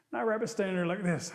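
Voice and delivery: ordinary delivery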